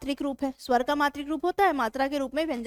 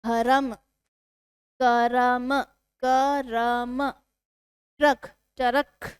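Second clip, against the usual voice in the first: drawn out syllable by syllable; slow motion; much slower than usual. Shown as a demonstration. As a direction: stretch each sound very slowly. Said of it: "भ्रम को slow motion में बोलिए".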